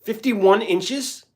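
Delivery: can't believe the number; as if the chat said something absurd; stunned disbelief. Real, not acted. Misreads chat, exaggerated disbelief.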